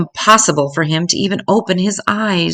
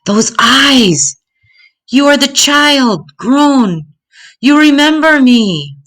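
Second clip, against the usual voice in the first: stunned recognition; 10 semitones higher, 4 dB louder, slower than usual. Acted. Thief recognizes the child, now grown.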